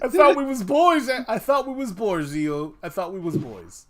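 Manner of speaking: loud, high mock-wounded cry; mock betrayal. Friend mock-hurt after losing a vote. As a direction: act hurt like a betrayed buddy.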